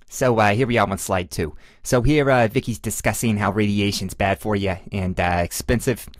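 put-on Boston accent, played for laughs; hammy, badly faked Boston accent. Shown as a demonstration. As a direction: do your best amateur Boston accent. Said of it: "in my best Boston accent".

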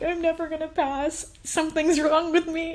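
high, teary whine of despair; a retold teen's tearful wail. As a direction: wail it like you've given up. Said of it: "crying and being like".

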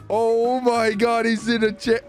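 ordinary talk

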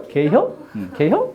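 short Chungcheong two-syllable question; fast Chungcheong dialect. Shown as a demonstration. Teacher showing the shortened question, quick Chungcheong dialect. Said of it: "충청도 말 굉장히 빨라요".

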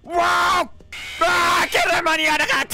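high, loud scream into fast yelling; shriek, then blurt it out high; panicked shriek. Real, not acted. Gamer too late to stop the monster, panicked shriek. Attacked before she could react.

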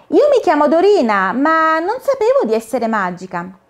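a hint of a startled child; little girl. Dorina tells the caterpillar her name, surprised.

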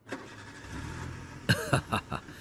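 soft, airy flattered laugh under the breath; breathy chuckle. Acted. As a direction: let out a quiet breathy laugh. Flattered by listener's remark.

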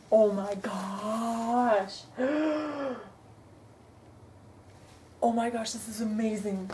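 very slow, drawn-out awe then gasp; overwhelmed oh my gosh; awed. Real, not acted. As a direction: stretch it out in wonder and gasp. Opens the tote bag.

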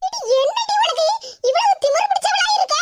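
high, sharp telling-off of a cheeky daughter; mother shrilly rebuking the daughter sitting on the doorstep; scolding mother. Acted. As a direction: scold her for her arrogance.

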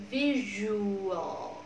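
"Visual" drawn out slowly, syllable by syllable; syllables stretched. Shown as a demonstration. Teacher splitting the word for the class, slow syllables. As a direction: stretch "Visual" so every part is felt. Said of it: "mình tách ra cho các bạn cảm nhận dễ".

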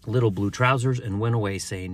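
ordinary delivery, nothing marked

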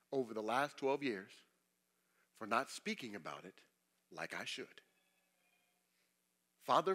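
soft, hushed confession; prayer. Real, not acted. Pastor confessing to God before his congregation, soft and hushed. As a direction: quietly ask forgiveness like a prayer.

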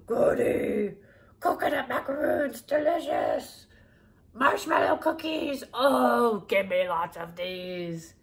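loud, slow, gleeful monster voice; loud greedy monster reading a list; greedy Cookie Monster. Acted. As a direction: gloat over each cookie. Cookie Monster raiding shelves.

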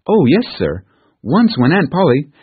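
put-on bright child jumping in; eager little girl. Narrator voices Pollyanna.

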